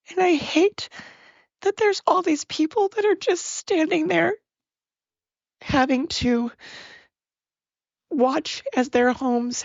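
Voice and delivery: high, strained voice close to tears; voice breaking. Real, not acted. Upset about people left to watch their homes destroyed, voice breaking. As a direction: she can barely hold back the tears.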